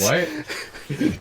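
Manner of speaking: high, drawn-out stunned what; shocked what. Retold or imitated. Retelling his shock at her text. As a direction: recoil in disbelief. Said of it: "I was like".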